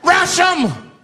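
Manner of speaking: high mock battle cry; screamed out. Snapped passenger rallying the bus, high scream. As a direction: yell it as a charge order. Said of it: "He screamed out".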